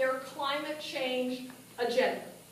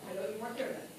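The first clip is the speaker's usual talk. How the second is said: ordinary talk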